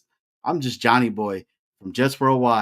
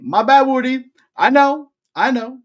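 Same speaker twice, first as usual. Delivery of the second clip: high-pitched mock apology; 12 semitones higher, 8 dB louder than usual. Host playfully apologises to Woody for his take, mock apology. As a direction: squeal the apology, much higher and louder.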